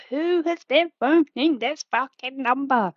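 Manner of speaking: imitating a furious parent over the phone bill; angry parent. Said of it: "it would be like".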